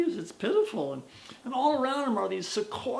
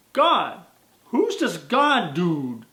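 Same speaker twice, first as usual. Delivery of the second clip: loud incredulous "God?"; disbelieving, slow question; loud baffled young man; surprised; 9 dB louder, much slower than usual. Acted.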